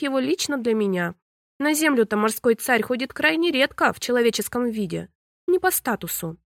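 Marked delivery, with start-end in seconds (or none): none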